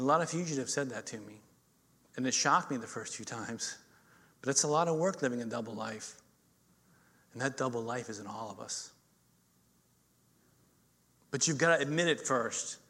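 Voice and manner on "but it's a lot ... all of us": ordinary delivery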